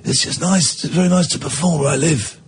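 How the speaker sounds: a hint of a croaky rock drummer. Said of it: "So he's got a croaky voice".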